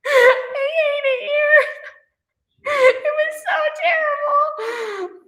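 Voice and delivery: high, slow fake sob through laughter; mock crying. Fan mock-sobbing over Gotham ending, high and slow. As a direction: wail it like a heartbroken fan, half laughing.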